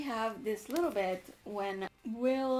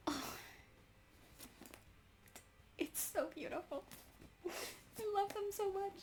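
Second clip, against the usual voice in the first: gushing softly; 10 semitones higher, 9 dB quieter than usual. Real, not acted. Gushing over the couple.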